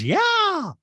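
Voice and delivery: loud, very high mock yelp; exaggerated piercing impression; Dorit. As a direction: squeal it out in falsetto. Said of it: "And Dorit goes".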